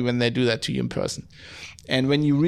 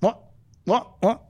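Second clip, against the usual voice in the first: short, higher-pitched repeated interjection; rapid-fire imitation of a wrestler's taunt; Stone Cold catchphrase; 6 semitones higher than usual. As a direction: cut each question short, with a beat between. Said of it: "you just go".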